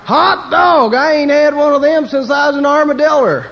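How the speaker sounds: high, loud mock Texan whoop; Texan accent. Texan thrilled at drink offer, high whooping Texas accent. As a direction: whoop it up in a caricature Texas twang. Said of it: "you get to the Texan".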